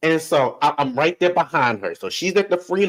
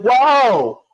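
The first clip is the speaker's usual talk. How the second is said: startled joke; 8 semitones higher, slower than usual. Retold or imitated. Grandma swerves while driving.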